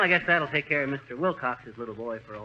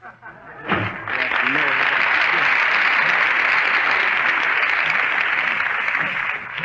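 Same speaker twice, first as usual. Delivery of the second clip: wheezy laugh; 5 semitones lower, 7 dB louder than usual. Acted. Laugh after being fleeced.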